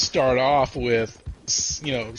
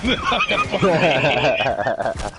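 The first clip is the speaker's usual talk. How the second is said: normal talking voice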